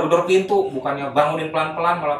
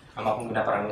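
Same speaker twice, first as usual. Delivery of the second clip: usual speaking voice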